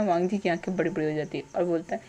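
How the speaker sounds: usual speaking voice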